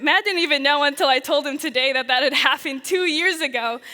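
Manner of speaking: usual speaking voice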